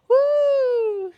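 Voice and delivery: high, loud laughing whoop; a real triumphant cheer; joyful woo. Real, not acted. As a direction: whoop with delight. Celebrates reaching the end.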